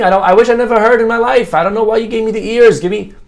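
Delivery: high and rushed, aggrieved; frantic complaint. Acted. Cured deaf husband pleads with the doctor, frantic. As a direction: tumble out the grievance fast.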